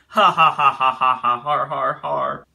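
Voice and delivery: loud ha ha laugh fading into har har. Real, not acted. Laughing at Jemmy's witch frog drawing, loud fading laugh. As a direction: belt the ha ha out, then let it fall off into har har.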